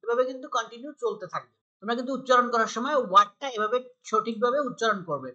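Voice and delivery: ordinary talk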